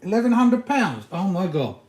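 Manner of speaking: shocked at price. Real, not acted.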